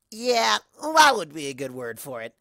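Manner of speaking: brash and pleased with herself; Rainbow Dash. Acted. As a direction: Rainbow Dash answering smugly, cocky.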